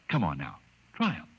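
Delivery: usual speaking voice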